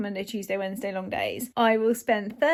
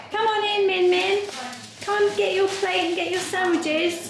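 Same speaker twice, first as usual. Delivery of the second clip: calling out; 8 semitones higher than usual. Real, not acted. Calling guests in to party.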